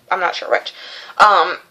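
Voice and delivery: ordinary talk, nothing marked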